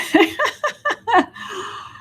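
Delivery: high laugh through tears; a real laugh through tears; teary laugh. Real, not acted. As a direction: laugh it off while crying.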